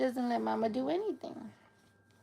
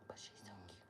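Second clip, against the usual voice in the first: soft breathy whisper of adoration; 28 dB quieter, much faster than usual. Real, not acted. Owner whispering over her sleeping puppy.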